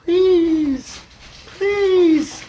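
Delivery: high, drawn-out plea; begging. Retold or imitated. Man pleading with the sky, acted high begging. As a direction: stretch each please out in desperation. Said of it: "So I start begging the sky".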